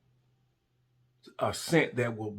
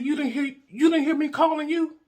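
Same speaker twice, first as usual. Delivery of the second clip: retold indignation, pitch shooting way up; indignant challenge; 4 dB louder than usual. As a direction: confront him in a high, incredulous voice. Acted quarrel with partner.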